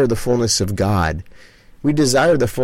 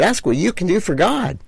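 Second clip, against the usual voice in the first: much higher, ringing call to the crowd; rousing challenge; 10 semitones higher than usual. Acted. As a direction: lift the question up like a rallying cry.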